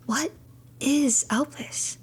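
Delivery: normal talking voice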